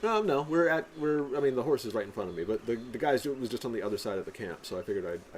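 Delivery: ordinary talk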